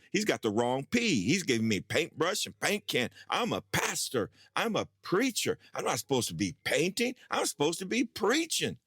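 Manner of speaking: climbing, exasperated self-pity; indignant rant. Retold or imitated. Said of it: "literally saying out loud".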